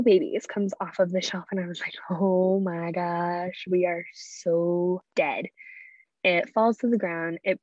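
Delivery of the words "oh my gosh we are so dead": very slow, stretched-out dismay; drawn-out dread. Retold or imitated. Child to sibling after breaking grandma's keepsake, slow dread. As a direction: drag it out in retold disbelief.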